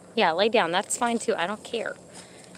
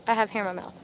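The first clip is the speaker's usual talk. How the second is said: usual speaking voice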